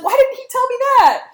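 high, loud, fast indignant whine; exasperated wife complaining; annoyed wife. Retold or imitated. Lady Macbeth fuming about her husband, shrill mock outrage. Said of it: "And she's like".

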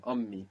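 Ummi with the m held long; doubled m. Shown as a demonstration. Teacher models the double m in Ummi. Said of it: "there's two M's here".

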